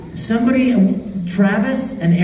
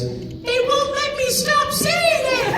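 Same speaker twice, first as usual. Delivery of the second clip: much higher, slow whining voice; whiny kid Aaron; 5 dB quieter, slower than usual. Retold or imitated. Young co-star on the phone, high slow whine. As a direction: complain like a worn-out kid. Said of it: "he goes".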